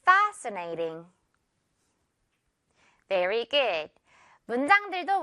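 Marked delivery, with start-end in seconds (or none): 0.1-1.1 "Fascinating" slowly as FAAS-uh-nay-ting; "Fascinating" with one s, falling low after FAS; model pronunciation. Shown as a demonstration. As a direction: model "Fascinating" for repeating, long first syllable. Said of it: "한 번 더요".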